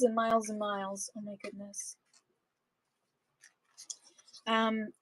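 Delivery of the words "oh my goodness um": plain reading voice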